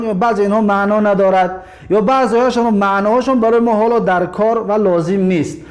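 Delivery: ordinary talk, nothing marked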